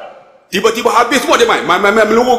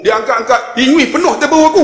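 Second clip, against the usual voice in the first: ordinary talk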